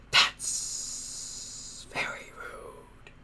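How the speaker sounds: slow, offended creature whisper; a hurt monster hissing softly. Acted. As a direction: hiss it, wounded in pride. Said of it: "it hissed, eventually rather hurt".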